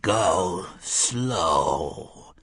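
low, drawn-out voice from beyond; dragging clotted voice. A narrator's reading. Dead voice inside the wall warning the gunslinger, dragging. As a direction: ooze the words out from the grave. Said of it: "a dragging, clotted voice said".

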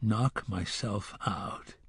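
put-on the gunslinger quietly repeating the boy's words to himself; inner thought. A narrator's reading. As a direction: mull it over under your breath. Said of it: "he thought".

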